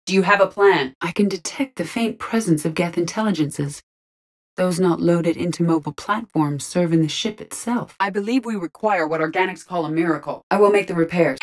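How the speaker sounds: ordinary talk, nothing marked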